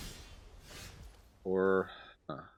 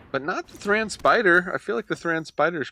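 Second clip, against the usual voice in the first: surprised; 9 semitones higher, 7 dB louder than usual. Real, not acted. Surprised outburst at opponent's play.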